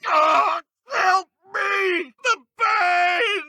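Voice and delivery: high, loud anguished cries; crying out in pain. Acted. Wounded dark wizard begs for help, high crying shouts. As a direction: wail for help through the pain. Struck down in combat.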